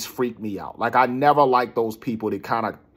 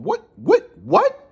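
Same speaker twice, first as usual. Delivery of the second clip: very high, loud, incredulous repeats; worked-up, pitch shooting way up; pissed; 5 dB louder, much slower than usual. Retold or imitated. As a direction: fire off each word in outraged disbelief. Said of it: "I'm, like, pissed".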